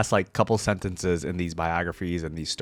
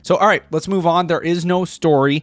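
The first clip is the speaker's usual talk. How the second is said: excited; 8 semitones higher, 7 dB louder than usual. Real, not acted. Hyped transition to next segment.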